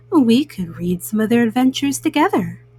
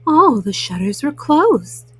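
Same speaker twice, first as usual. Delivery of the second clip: gentle, unhurried read-aloud for little ones; bedtime story reading. A narrator's reading. Mama Bunny reading Frog and Toad to children, gentle storytelling. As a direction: read it like a bedtime picture book. Reads picture book to children.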